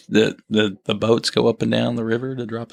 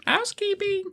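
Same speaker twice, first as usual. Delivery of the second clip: high mock maid voice; 7 dB quieter than usual. Knocks on captain's door.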